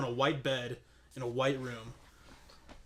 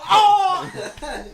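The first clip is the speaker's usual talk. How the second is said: mock gasp; 11 dB louder than usual. Mock girlfriend's surprise, laughing.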